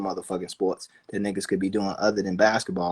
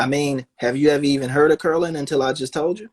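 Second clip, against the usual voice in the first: ordinary talk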